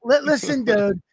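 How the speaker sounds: high mock outrage through laughter; laughing accusation. Real, not acted. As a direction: blame him playfully. Mock blaming friend.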